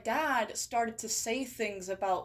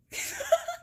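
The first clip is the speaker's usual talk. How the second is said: high squeaky laugh. Real, not acted. Laughing at own lore.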